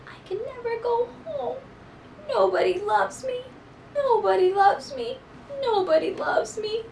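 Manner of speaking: high, soft, forlorn repeated plaint; sad lament. Acted. Maisie whimpers to herself that nobody loves her, high and soft. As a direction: cry it softly to yourself. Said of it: "words that poor Maisie said".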